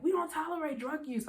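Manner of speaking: high, mock strict warning; stern manager. Managers warning sleepy worker, high mock stern. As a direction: imitate a prim boss scolding. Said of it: "They were like".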